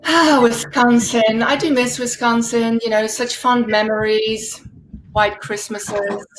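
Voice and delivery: normal talking voice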